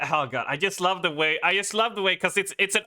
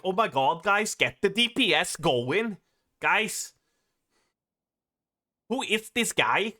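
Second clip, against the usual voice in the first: put-on higher, sing-song Swedish accent; imitated Swedish accent; 5 semitones higher than usual. Streamer imitating raid-group guy, exasperated Swedish accent. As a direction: plead in an exaggerated Swedish lilt. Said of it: "he had that accent".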